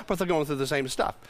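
ordinary talk, nothing marked

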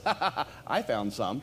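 low chuckle leading into the words; imitated laughing. Pastor retelling Jack's answer, imitated laughing. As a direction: imitate a man laughing as he admits it. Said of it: "he started laughing".

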